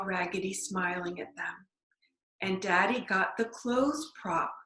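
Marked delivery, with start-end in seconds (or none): none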